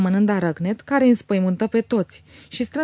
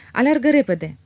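higher, louder call to hurry; urgent shout; 5 semitones higher, 4 dB louder than usual. A narrator's reading. Otilia shouts at the coachman to hurry, urgent. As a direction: shout the order to race. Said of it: "strigă Otilia".

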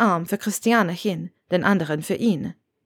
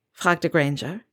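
ordinary delivery

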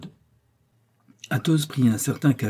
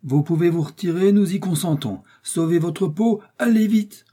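raised, high-pitched order to leave; commanding call; 8 semitones higher, 4 dB louder than usual. A narrator's reading. Jussac telling D'Artagnan to save himself, commanding call. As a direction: urge him off, building to a shout. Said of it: "cria Jussac".

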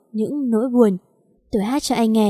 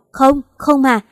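louder, higher despairing cry; a loud, high hopeless wail; 4 semitones higher, 5 dB louder, slower than usual. Acted. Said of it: "Tôi gào lên vô vọng".

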